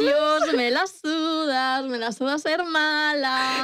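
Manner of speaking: high squeal then slow cocky drawl; a brat who no longer cares, slowly; mock defiant kid. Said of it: "Y yo". (niña chula y pasota, muy aguda al empezar)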